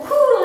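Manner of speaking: high, loud "pooh" puff; sudden burst of mimicked noise; imitated whoosh. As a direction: sound out a miracle in one blast. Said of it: "God could have just went".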